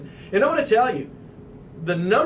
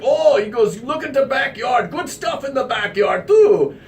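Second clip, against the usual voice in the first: put-on higher, half-shouted Russian accent; mock Russian owner calling out about the backyard; 6 semitones higher, 3 dB louder than usual. Owner urging the visitors outside in a higher Russian accent, half-shouting. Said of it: "thick Russian accent".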